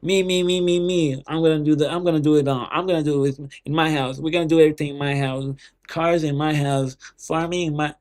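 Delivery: loud, bragging mock voice of a greedy leader; selfish loner. Mocking the leader who ditches the group, loud and selfish. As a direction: hammer every me like a spoiled kid grabbing it all. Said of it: "He was the guy in the group saying".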